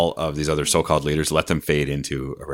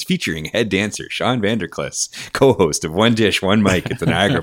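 announcer voice; 3 semitones higher, 4 dB louder than usual. Pretend. Hypes co-host like an announcer.

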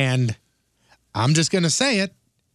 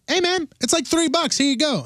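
high, light retold call; retold friendly offer; 11 semitones higher than usual. Host retells giving panhandler three dollars, high retold offer. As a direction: call it out high, handing over change. Retold handing over the change.

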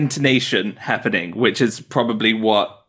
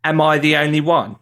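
Essex accent with a broad jaw; 3 semitones higher than usual. Shown as a demonstration. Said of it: "and then in Essex, it's more like".

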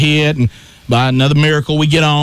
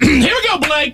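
high, strained cheer from the stands; high-pitched sideline call; 11 semitones higher than usual. Retold or imitated. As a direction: call out high to your kid. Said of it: "try to do one of these kind".

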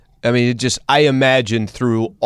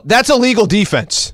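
high-pitched protest call; acted yell; 10 semitones higher than usual. Dad watching kid play basketball, acted yell. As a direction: call it out like a sports parent. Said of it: "I'm gonna yell".

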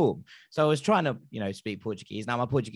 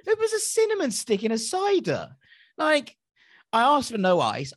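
outraged disbelief; 12 semitones higher than usual. Real, not acted. Guest ranting to hosts about his cider, high and outraged. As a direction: pitch it way up in comic indignation over a cinnamon stick.

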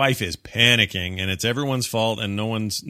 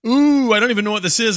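high, loud thrilled squeal; excited rabbit; 10 semitones higher, 6 dB louder than usual. Retold or imitated. Cartoon rabbit thrilled by his new gadget. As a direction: squeal with delight over a box. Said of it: "And he says".

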